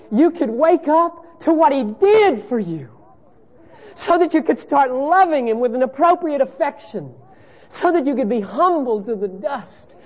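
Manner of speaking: high, loud pulpit preaching; preaching at full force. Acted. Preacher pressing the congregation on faith, in full voice. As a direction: drive the point home from the pulpit.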